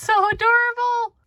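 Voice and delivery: high cooing over cute kittens. Real, not acted. Cooing over baby cat photos.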